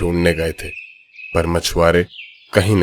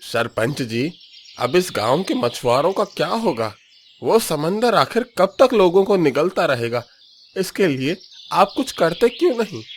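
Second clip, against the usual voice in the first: high-pitched worried demand; anxious villager; 9 semitones higher than usual. Acted. Villager demanding the headman act, voice climbing high. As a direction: press the headman, voice rising higher.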